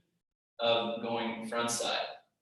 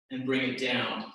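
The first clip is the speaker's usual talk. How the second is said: ordinary talk, nothing marked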